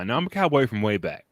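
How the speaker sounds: ordinary talk, nothing marked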